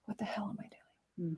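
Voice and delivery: hushed whisper to herself at the sketchbook; whispered self-doubt. Retold or imitated. Artist whispering doubts over her sketchbook, hushed. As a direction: breathe it out, lost and unsure.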